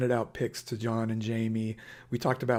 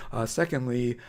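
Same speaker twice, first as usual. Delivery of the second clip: usual speaking voice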